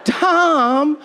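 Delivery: very high, slow put-on voice; drawn-out, high-pitched reproach of Tom; mock dismayed scolding. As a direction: act out someone appalled, stretching each word high. Voices someone scolding the comedian.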